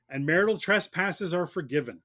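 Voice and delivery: ordinary talk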